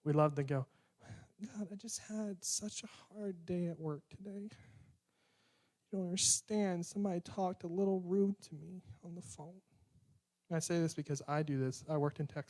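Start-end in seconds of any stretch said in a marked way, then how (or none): 1.4-9.6 mock whining prayer. Preacher mocking how we whine to God, whiny moan. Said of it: "we love to whine and complain to God".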